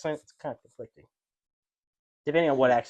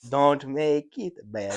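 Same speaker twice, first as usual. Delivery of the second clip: put-on botched British accent, laugh creeping in; bad British accent; much slower than usual. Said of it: "that's what Canadian trying to do a British sounds like".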